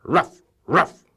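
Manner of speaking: high, loud dog bark. Acted. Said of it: "said Muffin McClay".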